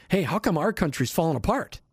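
normal talking voice

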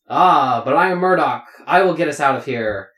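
lightly voiced proud leader; heroic stage voice. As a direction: declaim it like a lightly hammed play hero. Actor playing Murdoch in play.

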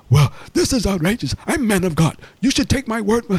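outraged mock African accent, high-pitched. Scammer demanding shop owner's trust, outraged African accent. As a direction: protest indignantly as an African caller. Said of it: "like, kinda African or something".

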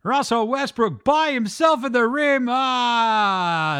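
Lakers commentator. Retold or imitated. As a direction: build excitement, then wail in dismay. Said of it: "even the Lakers commentators are like".

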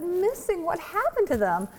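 high, startled questions; mock alarm. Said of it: "I said".

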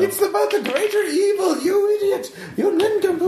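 very high, laughing villain rant; hammy villain rant. Acted. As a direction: screech it like a cartoon villain. Player villain rants.

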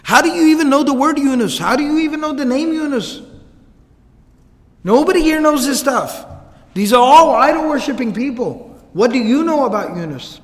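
high-pitched, insistent disbelief; astonished, rapid-rising questions that fall at the end; shocked. Retold or imitated. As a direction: fire questions as if utterly stunned. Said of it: "he's so shocked".

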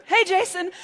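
high, loud cheerful greeting; greeting neighbor while running past; calling out. Retold or imitated. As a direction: call over to the neighbor.

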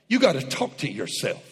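ordinary delivery

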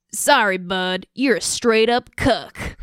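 slow, laughing attempt at an English accent; a hint of an English accent; mock English accent. Shown as a demonstration. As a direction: read it in a bad English accent. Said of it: "that's my attempt at an English accent".

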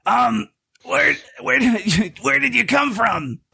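high, put-on creature voice, flustered; startled lizard person. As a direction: cry out in a high monster voice, caught off guard.

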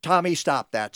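normal talking voice

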